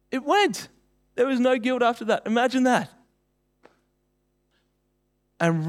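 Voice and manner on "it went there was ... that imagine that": excited. Real, not acted. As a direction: pitch leaps high in triumph. Triumphant climax of the story.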